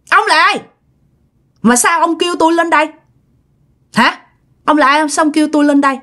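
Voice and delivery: put-on high, gritted voice of a dead girl speaking through a woman; possessed girl's voice. Possessed girl challenging the exorcist, high gritted voice. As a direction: snarl each question up high at the exorcist. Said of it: "nói ra cái giọng gằn gằn".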